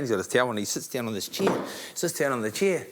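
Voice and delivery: ordinary talk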